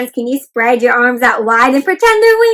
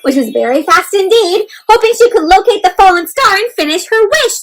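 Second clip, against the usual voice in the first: higher, louder chase narration; excited narration; 6 semitones higher, 6 dB louder than usual. A narrator's reading. Teacher reading Nimbus's chase after the star, excited and louder. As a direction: read it with racing energy. Reads story text aloud.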